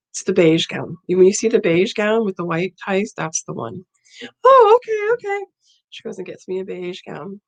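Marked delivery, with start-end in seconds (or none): 4.5-5.5 high, loud chirpy nurse imitation; mock nurse voice. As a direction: play the nurse giving in, pitched way up. Nurse voiced in retold exchange.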